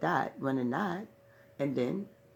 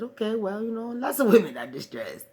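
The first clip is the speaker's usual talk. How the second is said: higher, louder sassy retort; sassy pushback; 6 semitones higher, 6 dB louder than usual. Retold or imitated. Woman arguing with God about going over, sassy pushback. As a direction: talk back to God with attitude.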